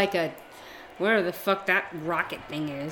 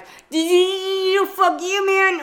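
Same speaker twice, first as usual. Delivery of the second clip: mock tough guy; 10 semitones higher, 5 dB louder, much slower than usual. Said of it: "he's like".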